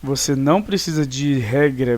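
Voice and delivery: normal talking voice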